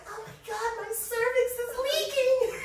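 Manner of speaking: put-on very high squeaky girl voice; anime girl voice. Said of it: "And then the girl was like".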